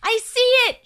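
high, loud excited shout. A narrator's reading. Will spots the runaway nose, shouting. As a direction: shout out the sighting at full voice. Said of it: "shouted Will".

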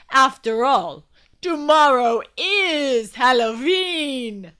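loud, slow, put-upon vampire voice; a vexed vampire refusing, drawn out. Acted. Vlad to Glum, turning down the party, vexed and loud. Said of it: "Vlad looked vexed".

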